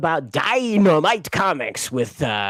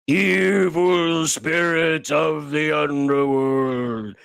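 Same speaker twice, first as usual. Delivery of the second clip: slow, drawn-out evil sorcerer chant; hammed-up evil wizard invocation; villain incantation; much slower than usual. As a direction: intone it like a cartoon villain summoning spirits. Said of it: "that's what he said".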